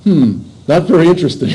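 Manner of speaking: a listener who's bored by it. Retold or imitated. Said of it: "you're like".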